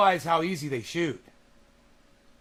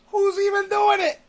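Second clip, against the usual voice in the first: high, loud shouting ranter; mock rant; 12 semitones higher, 7 dB louder than usual. Mocking an angry commenter in a high shout. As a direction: yell it like an outraged grump.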